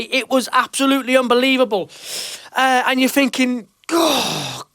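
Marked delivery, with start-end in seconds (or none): 3.9-4.6 higher and higher, real excitement rising; getting worked up. Real, not acted. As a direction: let the excitement run away with you. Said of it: "I'm getting worked up".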